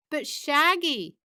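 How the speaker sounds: high, drawn-out cartoon dog voice; Scooby. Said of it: "worst Scooby impression".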